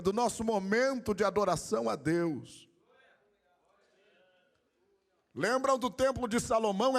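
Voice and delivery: high-pitched, driving sermon delivery; fervent preaching. Real, not acted. Pastor telling the brothers that life revolves around worship, fervent preaching.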